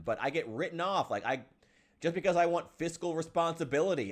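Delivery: usual speaking voice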